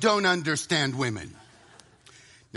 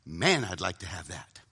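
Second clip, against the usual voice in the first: low, soft inner voice of temptation; much lower, softened tempter's aside; enemy's tempting thought; 13 semitones lower, 5 dB quieter than usual. Acted. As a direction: slip it in low and quiet, like a stray thought. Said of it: "Now the enemy, ... says".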